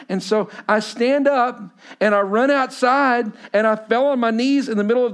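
high, urgent sermon storytelling; impassioned testimony preaching. Real, not acted. Preaches panic story at full force.

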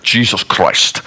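low, badly faked Scottish accent; Sean Connery. Preacher trying out a Scot for the congregation, rough low Scottish accent. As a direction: attempt a deep Scottish film-star brogue. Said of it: "like Sean Connery or whatever".

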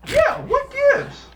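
very high, slow, loud indignation; mock outrage. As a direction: whine it like a scandalized prude.

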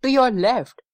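high, urgent direction; mild yell. A narrator's reading. Pa directs Grandma from the window, mild yell. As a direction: call out a warning, lightly acted. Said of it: "yelled Pa".

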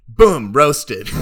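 shouted, proud "Boom, roasted"; triumphant. Pretend. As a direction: slam down the punchline. Mock roast punchline.

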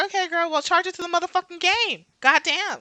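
high, sassy brush-off. Retold or imitated. Telling a friend to shrug off the liar, high and sassy. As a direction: toss it off like a seasoned friend. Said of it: "So I was like".

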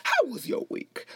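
very high talk-show catchphrase voice; Wendy Williams. Shown as a demonstration. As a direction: start way up high and swoop down. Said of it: "that way, like".

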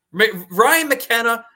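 worked-up and incredulous; incredulous exasperation. Real, not acted. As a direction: protest in disbelief, voice climbing high. Incredulous rant about player staying.

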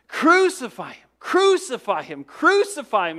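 loud, high angry yell; an angry crowd shouting at Pilate. Retold or imitated. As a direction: shout it as a furious mob demanding death. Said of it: "cries out in an angry voice".

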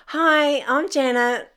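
put-on eager new girl's greeting; chirpy hello. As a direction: sing out a cheerful hi to tough cellmates. Cheery greeting to cellmates.

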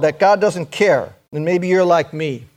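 ordinary talk, nothing marked

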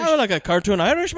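mock Irish accent. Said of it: "his god-awful accent".

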